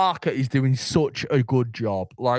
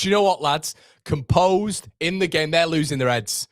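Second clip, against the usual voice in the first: ordinary talk, nothing marked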